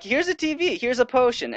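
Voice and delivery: high, chirpy townsperson voice; generous NPC voice. Pretend. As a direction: hand it over like a cheery villager. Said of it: "they're like".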